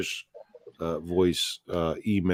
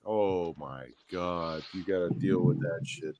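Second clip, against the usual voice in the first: mock exasperation; 3 semitones lower, 9 dB quieter than usual. Acts out weary reaction to caller.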